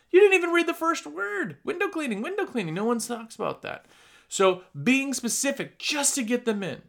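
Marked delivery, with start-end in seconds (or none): 0.1-2.7 high-pitched mock exasperation; incredulous, pitched well up; exasperated retort. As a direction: protest in a raised voice to someone who missed the obvious. Exasperated retort to dismissive passer-by.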